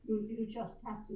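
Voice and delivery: ordinary delivery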